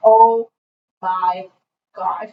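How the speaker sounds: drawn-out, word-by-word disbelief; retold disbelief. Friends watching her dig through trash, retold disbelief.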